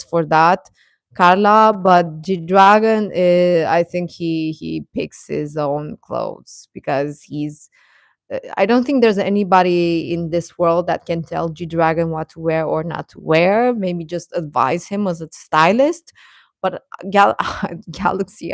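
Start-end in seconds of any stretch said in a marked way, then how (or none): none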